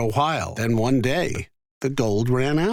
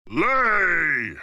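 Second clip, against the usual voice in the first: giant's shout; 5 semitones higher than usual. A narrator's reading. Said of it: "Jack heard the giant shout".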